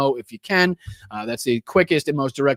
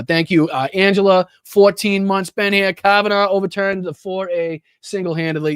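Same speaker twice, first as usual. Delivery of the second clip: ordinary talk, nothing marked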